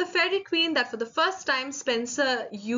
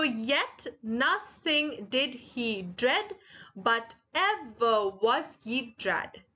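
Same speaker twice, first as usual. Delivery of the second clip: very slow, each syllable set apart; counting out syllables; much slower than usual. Shown as a demonstration. Teacher counts a poem line with students, syllable by syllable. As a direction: read it beat by beat for counting. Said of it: "It has to be of twelve syllables".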